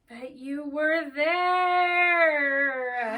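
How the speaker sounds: slow, drawn-out pleading; slow, teary mock plea, half-crying; mock tearful plea. A pleading line in a retold scene.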